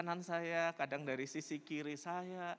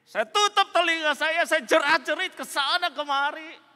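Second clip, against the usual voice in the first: loud, high-pitched distressed cry; frantic outcry; 14 semitones higher, 14 dB louder than usual. Acted. Tormented woman tells pastor she screamed, frantic outcry. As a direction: cry out as if hounded by voices.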